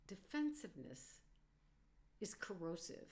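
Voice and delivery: ordinary talk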